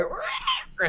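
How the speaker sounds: dinosaur roar. Retold or imitated. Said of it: "It was like".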